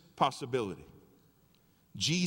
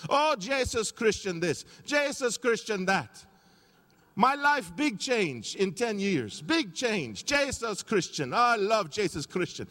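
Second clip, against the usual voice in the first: imitating George; 7 semitones higher, 4 dB louder, slower than usual. Telling the pastor his changed life, lightly imitated. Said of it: "That's how he was saying Jesus Christ".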